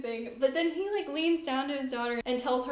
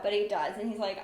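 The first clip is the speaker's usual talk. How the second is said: usual speaking voice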